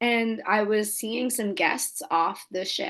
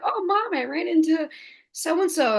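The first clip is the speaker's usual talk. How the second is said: chirpy daughter to mom; 8 semitones higher than usual. Retold or imitated. Retold chat with mom, high voice.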